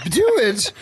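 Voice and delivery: high, hammed-up command; prissy imitation. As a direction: jokingly mimic a prim, high voice. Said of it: "it's prissy".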